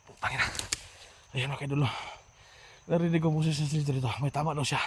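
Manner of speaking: hushed. Real, not acted. Hiding after being shot at.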